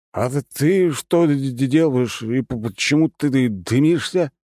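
slow, stammering tipsy words; drunk slurring. Acted. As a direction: stumble drunkenly over the words. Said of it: "Язык у меня сильно заплетыкался". (пьяно, заплетающимся языком)

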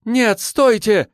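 desperate high shout. A narrator's reading. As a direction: cry out to stop them. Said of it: "крикнула Оксана".

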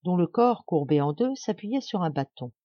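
plain reading voice

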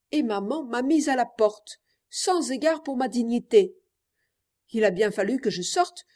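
ordinary delivery

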